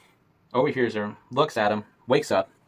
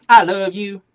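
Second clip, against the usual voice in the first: wife's voice, high and louder; high loving wife voice; 9 semitones higher, 8 dB louder, slower than usual. A narrator's reading. Wife gushing to her rancher husband, high and bright. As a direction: gush it like a doting wife.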